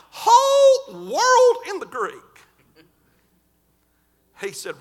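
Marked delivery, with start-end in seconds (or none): 0.1-2.1 Brother Sammy. Retold or imitated. Preacher Brother Sammy teaching girls.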